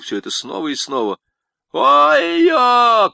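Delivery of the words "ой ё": a very high, loud cry; shout. A narrator's reading. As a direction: yell it out to the sky. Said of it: "крикнул Пупс".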